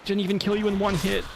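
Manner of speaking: ordinary delivery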